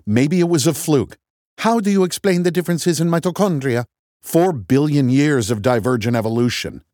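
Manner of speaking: loud, rising back-and-forth; heated argument. A narrator's reading. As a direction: push the argument harder. Escalating quarrel, leaning in.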